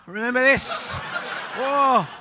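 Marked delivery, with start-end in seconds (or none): none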